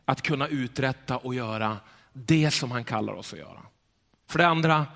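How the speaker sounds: ordinary talk, nothing marked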